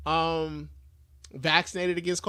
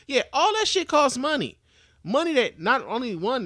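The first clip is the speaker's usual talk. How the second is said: loud emphatic rant; 7 semitones higher, 4 dB louder than usual. Real, not acted. Ranting about treatment costs.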